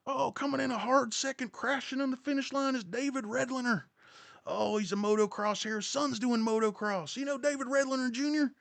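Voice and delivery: high, excited race-caller voice; hammed-up motocross commentator; motorsports announcer. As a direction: call him home like a race announcer. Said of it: "you could be like a motorsports hero".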